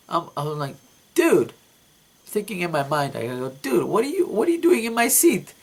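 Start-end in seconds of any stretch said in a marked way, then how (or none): 1.1-1.5 a high, loud "Dude"; shocked. Retold or imitated. Dreamer spots a man in his seat, shocked high call. 3.5-5.5 much higher and louder, outraged question; indignant outburst. Retold or imitated. Dreamer to the man in his seat, high indignant outburst.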